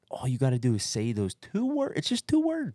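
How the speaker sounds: high tempting devil voice; mock devil voice. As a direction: tempt him like a sly little devil. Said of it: "it was like the angel and the devil".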